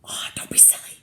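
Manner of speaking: soft scoffing whisper; dismissive whisper. Acted. Second burglar reassuring partner in a whisper. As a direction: brush it off in a whisper. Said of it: "whispered the other burglar".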